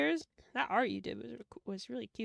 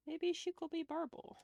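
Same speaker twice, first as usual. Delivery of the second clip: pitched up, playful and coaxing; higher playful pitch; 6 semitones higher, 5 dB quieter than usual. Real, not acted. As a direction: repeat it higher to sell the idea. Suggestion repeated twice.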